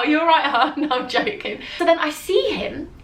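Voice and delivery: normal talking voice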